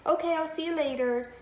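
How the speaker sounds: lightly acted girl on the phone to mom; inside voice phone chat. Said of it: "use her inside voice and just talk and say hi".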